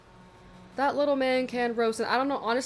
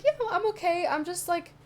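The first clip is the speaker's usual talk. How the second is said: fake-calm reassurance; 8 semitones higher than usual. Faking calm to toddler.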